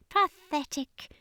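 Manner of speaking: high, slow, scornful alien voice; high alien sneer. Acted. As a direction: drip contempt on it. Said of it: "the commander sneered".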